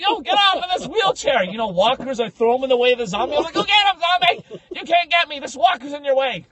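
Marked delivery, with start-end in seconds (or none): 0.0-1.4 high, loud frantic demand; mock panicked order. Cowardly visitor to wheelchair user, mock panicked shout. Acting out panicked escape from zombies. 3.5-6.5 high, loud taunt; mock panicked shouting. Cowardly visitor shouting to zombie, mock panic.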